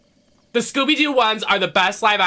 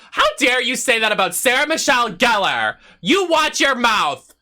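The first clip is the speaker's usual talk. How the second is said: mock outraged shout; 6 semitones higher than usual. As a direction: defend your queen like a furious fan. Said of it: "I'm so mad about it".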